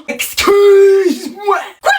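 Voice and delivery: very high, slow, drawn-out indignant excuse-moi; shocked outrage. Acted. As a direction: stretch it out in high disbelief. Parent shocked by claim.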